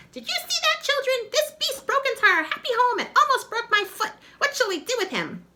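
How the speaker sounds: very high, loud shrill witch; rally the spider children against him; outraged witch, very high and loud. Acted.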